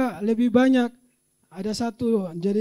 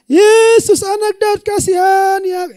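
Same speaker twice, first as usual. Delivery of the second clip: much higher and louder desperate plea; blind man crying out; 9 semitones higher, 10 dB louder than usual. Acted. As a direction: cry out to Jesus for mercy at full voice. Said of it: "Itu kan pasti dia teriak".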